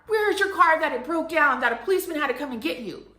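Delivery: high, loud demand, easing off as it runs on; outraged mother. Retold or imitated. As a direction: imitate a mom incredulously scolding. Mother demands answers about car.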